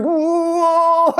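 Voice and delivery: put-on high, far-off toddler wail with a laugh; frustrated toddler wail. Speaker imitating a frustrated two-year-old, high mock wail. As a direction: whine it out like a tiny kid losing it.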